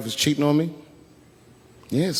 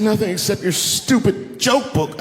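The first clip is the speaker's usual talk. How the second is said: slow, higher, contemptuous; scornful wife; 8 semitones higher than usual. Retold or imitated. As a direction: spit it out slowly, disgusted.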